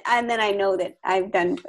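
ordinary talk

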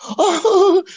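high laugh. Real, not acted. Laughing at own joke.

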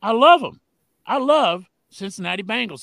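loud, high burst of fan love; excited fan. Real, not acted. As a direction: shout out his love for the team. Fan declaring love for his team.

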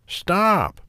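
high-pitched pig's cry to halt; lightly voiced pig calling out high; snorting pig. A narrator's reading. Pig shouts at the passing gingerbread man, high lightly voiced cry. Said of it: "snorted the pig".

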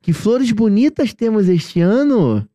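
sing-song "Que flores bonitas", stretched out to a swooping "ano"; exaggerated intonation. Shown as a demonstration. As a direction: show off the tune, rising high then falling on "ano". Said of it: "A entonação, ó".

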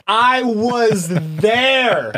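high, drawn-out, theatrical; slow, high, hammy testimony; dramatic witness voice. Pretend. Repeats the dramatic witness line.

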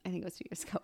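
hushed aside to herself; whispering. Real, not acted. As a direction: drop to a whisper. Unsure aside, said under breath.

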